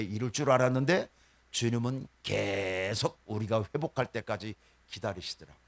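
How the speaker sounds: normal talking voice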